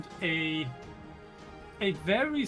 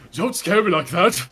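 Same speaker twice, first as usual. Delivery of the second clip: big booming giant, startled; loud giant startled by a passerby; giant's voice; 4 semitones higher, 10 dB louder than usual. Acted. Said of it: "says the Goliath back".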